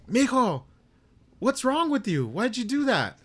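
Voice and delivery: mom's voice. Retold or imitated. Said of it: "and then she's like".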